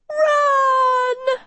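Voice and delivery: very high, long-held cry; screamed warning. A narrator's reading. Uma warns Agatha about the stampeding animals, screamed warning. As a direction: shriek it on one held note.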